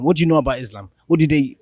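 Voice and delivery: usual speaking voice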